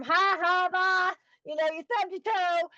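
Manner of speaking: high, mocking sing-song jab; teasing son voice. Grieving mom voices her son teasing her stubbed toe, high and mocking. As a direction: tease her like a smart-aleck son. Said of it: "I could hear him".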